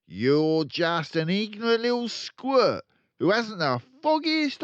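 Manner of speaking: loud, high tirade; angry ranting father. Acted. Father ranting at Matilda, loud and angry. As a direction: berate her without pause.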